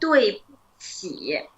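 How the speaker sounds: slow recap, syllables apart; slow model phrase. Shown as a demonstration. Teacher recaps the apology for student, slow and measured. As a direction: take it slowly, syllable by syllable.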